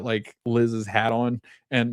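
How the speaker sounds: ordinary talk, nothing marked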